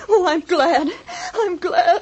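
half-sobbing with relief; tearful relief. Acted. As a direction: let relief break into tears. Relieved that doctor lives.

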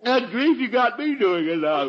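half-laugh, half-yawn. Acted. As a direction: caught yawning against his will. Dad laughing through yawn.

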